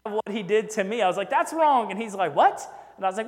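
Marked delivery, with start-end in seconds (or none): 1.3-1.9 higher, louder "that's wrong"; student blurting a correction at the professor; blurted objection. Retold or imitated. Retold outburst in class. 2.3-2.7 higher, louder startled "what"; surprised professor. Retold or imitated.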